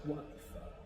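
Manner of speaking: hushed, baffled swear; whispered disbelief. Retold or imitated. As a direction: whisper it like you can't believe the text. Lucas reacts to prank text.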